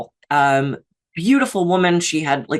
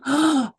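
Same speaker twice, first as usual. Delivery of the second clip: sharp acted gasp; mock gasp; 9 semitones higher than usual. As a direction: gasp in sudden dismay. Acted inner dialogue.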